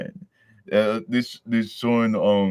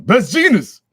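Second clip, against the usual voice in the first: higher, louder excited shout; hype call; 13 semitones higher, 11 dB louder than usual. Real, not acted. Vlogger spotting Best Jeanist, excited shout. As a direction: call out his name thrilled.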